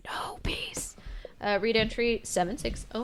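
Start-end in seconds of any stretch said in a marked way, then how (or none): 0.0-0.9 soft whisper. Acted. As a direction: breathe it out. Whispered repeat of No peace.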